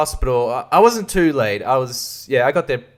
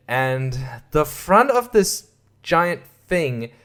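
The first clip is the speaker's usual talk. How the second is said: usual speaking voice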